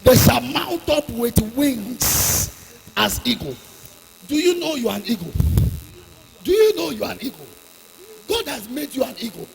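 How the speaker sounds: loud, driving sermon delivery; fiery preaching. Real, not acted. Preacher exhorting the congregation not to give up, fiery. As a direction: preach it hard to the crowd.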